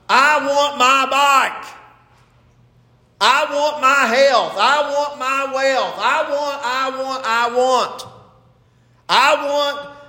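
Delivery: high, drawn-out, insistent chant; self-centred tantrum, put on; mock childish demand. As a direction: mimic a spoilt child demanding, each word stretched. Echoes the child's tantrum as churchgoers.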